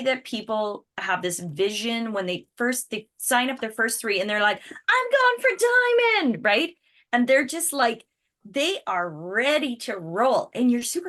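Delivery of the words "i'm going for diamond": high, loud and thrilled; excited vow. Retold or imitated. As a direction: shout out the big dream with glee. New recruits' big ambition.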